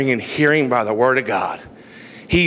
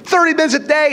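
preacher's raised insistence; emphatic repeat; 10 semitones higher, faster than usual. Shown as a demonstration. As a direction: hammer the point home on the repeat.

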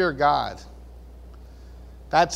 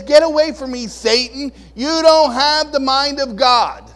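rebuke; 7 semitones higher, 7 dB louder than usual. Retold or imitated. Jesus rebuking Peter as Satan, loud stern rebuke. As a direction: cast the friend off sternly.

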